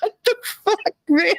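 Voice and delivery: high, laughing what-the-fuck; laughing disbelief. Real, not acted. Recalling the absurd condolences, high laughing disbelief. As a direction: crack up in disbelief. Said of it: "I was like".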